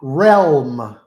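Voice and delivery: realm said loud and careful, falling; emphatic realm, not room; correcting realm. Shown as a demonstration. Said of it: "in this hell room, no".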